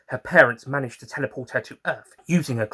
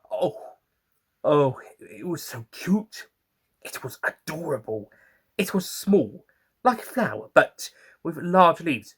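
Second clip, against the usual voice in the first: cooing over cuteness; 5 semitones higher than usual. Real, not acted. Narrator gushing over cute plant.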